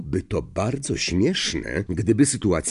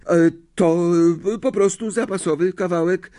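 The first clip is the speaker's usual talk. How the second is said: high, hesitant, would-be casual; stammer, then brush it off; feigned nonchalance; 10 semitones higher, 4 dB louder than usual. A narrator's reading. Pupil playing down a secret parchment, falsely casual. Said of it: "powiedział lekceważącym tonem".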